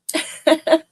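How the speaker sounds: a quick high laugh at her own joke; bright burst of laughter. Real, not acted. Host laughing at her own gift idea, bright laugh.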